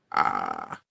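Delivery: ordinary talk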